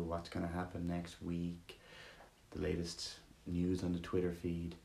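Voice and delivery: soothing guided meditation read. Acted. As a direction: ease them gently into stillness.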